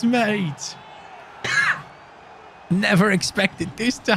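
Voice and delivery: high shouts breaking into laughter; disbelieving joy at the turnaround; screaming then laughing; ecstatic celebration. Real, not acted. Third goal celebration with laughs.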